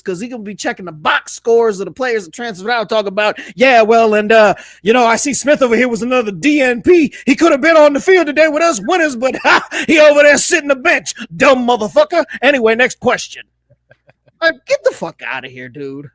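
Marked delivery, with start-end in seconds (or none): 3.6-13.4 loud, petty coach at the press conference; a bitter coach gloats to reporters, loud; gloating coach impression. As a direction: sneer at the transfer like a bitter coach.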